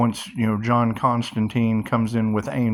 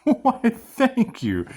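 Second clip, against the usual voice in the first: mock flattered; 11 semitones higher, much slower than usual. Flattered reply to the waitress.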